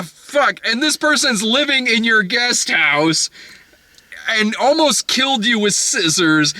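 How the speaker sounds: high, insistent mock scolding; exasperated rant. Wife berates husband about the stalker, exasperated. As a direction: berate him in disbelief, stretching the key words.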